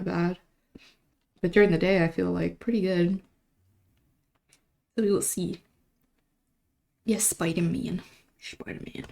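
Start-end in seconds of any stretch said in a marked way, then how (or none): 5.0-9.0 a soft sinister whisper; Green Goblin voice. Shown as a demonstration. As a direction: breathe the name like a lurking villain. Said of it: "Green Goblin voice".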